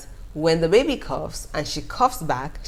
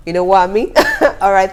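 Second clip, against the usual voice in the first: laughing; 3 semitones higher, 9 dB louder, faster than usual. Real, not acted. Laughs while signing off.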